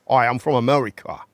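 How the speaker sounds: a weak American accent attempt; half-hearted American accent. Shown as a demonstration. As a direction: try an American accent, barely. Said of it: "your best American accent".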